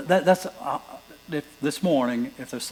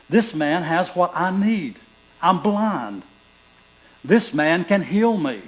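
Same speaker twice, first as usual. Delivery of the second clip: slow, raised, pleading voice of the blind man; blind beggar's hope; 4 semitones higher, 5 dB louder, slower than usual. Acted. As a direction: speak as the beggar who finally has hope. Voicing blind Bartimaeus' thoughts.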